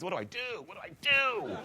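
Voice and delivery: high, climbing theatrical fretting; mock panic. Boy panicking the night before his audition, frantic. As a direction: build to a hammed-up frantic wail.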